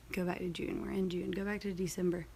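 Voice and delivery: soft whispered self-talk while scrolling; muttering to herself. Real, not acted. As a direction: mumble directions to yourself, barely voiced. Talking to herself scrolling calendar.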